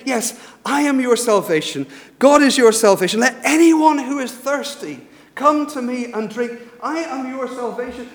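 loud, high-pitched preaching cry; impassioned proclamation. Retold or imitated. Jesus crying out to the thirsty crowd, impassioned proclamation. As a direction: proclaim it at full force.

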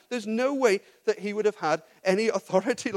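normal talking voice

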